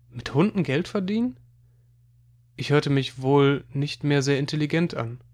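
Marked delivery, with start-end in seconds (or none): 0.1-1.4 higher, rising in disbelief; puzzled question. A narrator's reading. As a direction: echo it back, not understanding. Puzzled question back to Marcel. (ungläubig nachgefragt)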